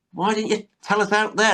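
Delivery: high, put-out question; mock Del indignation. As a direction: play it like an aggrieved Del. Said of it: "he says to Boise".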